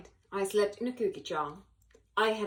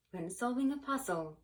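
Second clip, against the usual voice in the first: ordinary delivery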